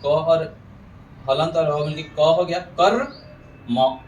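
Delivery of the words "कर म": कर म with a rolled र, then separate "ma"; correct pronunciation. Shown as a demonstration. Teacher models pure कर म, syllable-split demo. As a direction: hold the trill in कर, pause, release म. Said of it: "ऐसे शुद्ध उच्चारण है".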